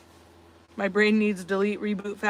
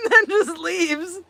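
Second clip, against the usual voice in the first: laughing; 7 semitones higher, much slower than usual. Real, not acted. Laughing while telling the news.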